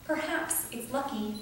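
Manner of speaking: measured poetry-reading voice with pauses between phrases; poem reading. Acted. Poet reading Green Thumb to an audience, measured poem reading. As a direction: read it as a poem, line by line. Reads own poem aloud.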